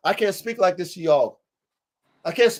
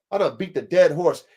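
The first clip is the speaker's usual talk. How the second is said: ordinary delivery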